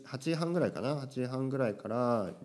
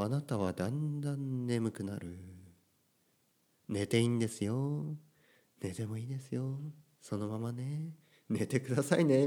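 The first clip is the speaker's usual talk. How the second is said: sleepy podcast voice; 5 dB quieter than usual. Shown as a demonstration. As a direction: ease the listener into sleep, hushed. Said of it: "そういう口調でね".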